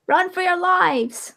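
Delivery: slow, high comic warning; mock alarm. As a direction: ham up the panic slowly.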